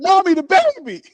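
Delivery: high, loud mock-teen whine; pleading daughter. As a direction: play a daughter wailing to mommy. Voicing the daughter to mom.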